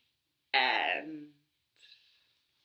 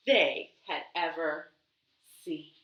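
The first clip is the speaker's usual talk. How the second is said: ordinary delivery, nothing marked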